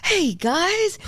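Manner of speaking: high, friendly attempt at a German accent. Friendly German security guard greeting travellers, attempted German accent. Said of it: "in his accent".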